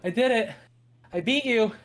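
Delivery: high, loud, panting triumph; out of breath. Acted. Sister brags to her sibling after a race, out of breath. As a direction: gasp it out loudly after winning a race. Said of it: "she's out of breath and she's like".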